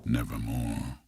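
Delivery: lightly voiced raven, low and quiet; raven's reply. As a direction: answer as the ominous raven, hushed and low. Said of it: "quoth the raven".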